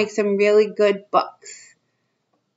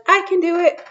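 put-on higher, eager kid voice; little boy voice; 8 semitones higher than usual. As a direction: pipe up like a proud little boy. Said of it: "The boy says".

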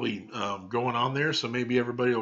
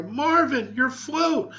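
much higher, alarmed cry; 13 semitones higher, 6 dB louder, much slower than usual. Acted. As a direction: yell out in sudden alarm. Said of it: "he heard his mother cry".

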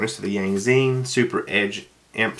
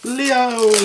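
excited shout; 13 semitones higher, 4 dB louder than usual. Real, not acted.

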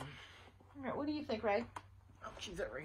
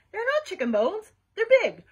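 loud, high indignant retort; 10 semitones higher, 10 dB louder than usual. A narrator's reading. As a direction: fire back at the doubters, pitched up. Indignant rebuttal in schoolyard quarrel.

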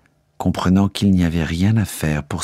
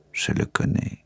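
softer, whispered admission; secret whisper; 7 dB quieter than usual. A narrator's reading. King reveals a secret. (chuchoté, comme un secret)

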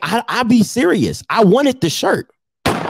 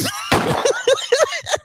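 high laugh; 4 dB quieter than usual. Real, not acted.

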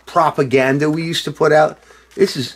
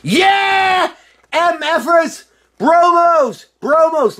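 put-on drunken cheering; 13 semitones higher, much slower than usual. Drunk rocker whooping over his reissued album, shouting. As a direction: yell it like a rock star celebrating. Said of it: "right now I'm just like".

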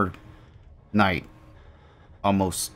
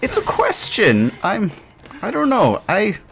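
flustered; 9 semitones higher, 5 dB louder than usual. Real, not acted. Flustered by a chat question.

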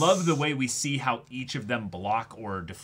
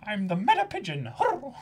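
high, cooing pigeon voice with hurr grunts; 9 semitones higher, 4 dB quieter than usual. Retold or imitated. To her friend, voicing Hattori the pigeon, high and cooing. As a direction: play Hattori as a smug pigeon.

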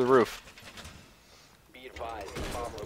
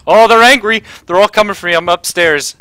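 loud, high excited shout; yelling across the room; 11 semitones higher, 18 dB louder than usual. Real, not acted. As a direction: yell it to your team. Under attack, shouting to teammates.

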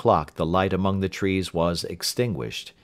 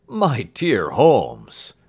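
slow, drawn-out and louder, amazed; astonished exclamation; 2 semitones higher, 6 dB louder, much slower than usual. Acted. Watson marvelling at Holmes's deduction, slow and loud. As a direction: let the amazement stretch each word.

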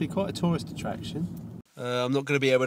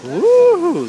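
excited ooh; 11 dB louder than usual. Real, not acted.

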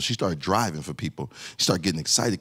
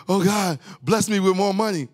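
mock prayer; 11 semitones higher than usual. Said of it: "we like".

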